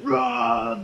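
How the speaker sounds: high-pitched mock roar; imitated yell. Acting out the guy shooting fire, mock yell. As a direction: let out a "rah" as if blasting fire. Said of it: "yelling at him".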